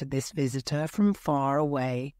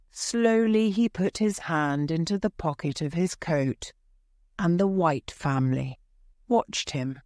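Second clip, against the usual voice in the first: ordinary delivery, nothing marked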